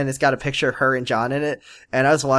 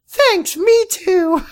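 very high, slow squeaky voice; cartoonishly high, drawn-out answer; mock sweet reply; much slower than usual. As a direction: stretch it out in a silly high pitch. Mocking reply to love line.

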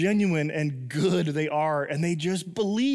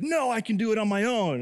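a hint of an insistent toddler, higher; stubborn toddler; 5 semitones higher than usual. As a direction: lightly play a proud little kid. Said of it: "he's like".

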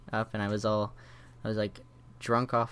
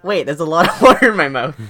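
loud, high, cracking up mid-line; laughing through it; 5 semitones higher, 13 dB louder than usual. Retold or imitated. Numb-mouthed patient realizing the water spill, laughing loudly. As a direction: retell the mishap while laughing. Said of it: "and then I'm like".

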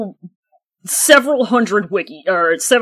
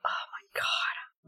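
whispering; 9 semitones higher, 19 dB quieter, slower than usual. Real, not acted. Stunned by the wiki page.

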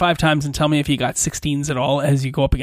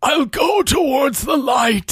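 dying paladin; 9 semitones higher than usual. Acted. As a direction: cry out toward the light as you die. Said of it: "says the paladin as he dies".

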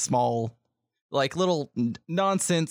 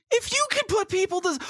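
shouted, much higher, in disbelief; dumbfounded outburst. Acted. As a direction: blurt it out, baffled and indignant. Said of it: "looks a little dumbfounded".

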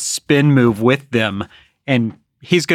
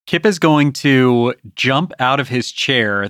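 ordinary talk, nothing marked

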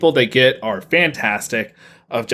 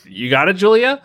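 imitated supporters now unsure, higher and rising; retold doubtful encouragement; 6 semitones higher than usual. Fans reacting to her dating a rapper, doubtful questioning cheer. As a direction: turn the cheer into a high question. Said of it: "folks were like".

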